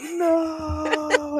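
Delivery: high, drawn-out mock wail; mock-horror cry, stretched out in slow motion. Acts out slow-motion sock falling.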